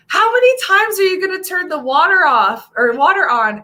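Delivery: mock his fed-up question; imitating husband. Wife mimics husband asking about the water. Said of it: "And then he finally goes".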